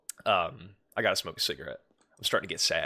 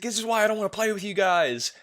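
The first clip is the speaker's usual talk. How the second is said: high, strained mock rage; Ninja freaking out; 11 semitones higher than usual. Streamer imitating Ninja's Among Us meltdown, high and upset. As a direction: whine it like a gamer losing it. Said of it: "He's like".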